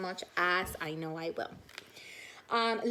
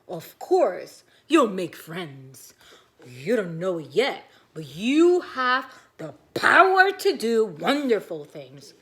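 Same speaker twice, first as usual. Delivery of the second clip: louder, lower comforting father voice; Daddy Smudge; 3 semitones lower, 10 dB louder than usual. Acted. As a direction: reassure the little one. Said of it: "And Daddy Smudge says".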